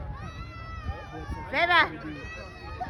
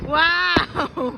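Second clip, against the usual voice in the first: normal talking voice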